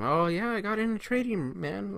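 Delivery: put-on easygoing high voice; casual boast to friends. Acts telling friends about trading.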